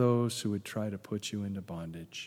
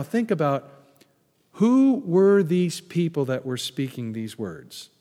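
usual speaking voice

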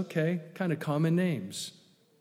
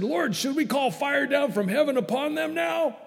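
put-on high, eager hot-headed young man; hot-headed disciple; 9 semitones higher than usual. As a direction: pitch up like a fired-up disciple. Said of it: "these boys were thunderous young guys who said".

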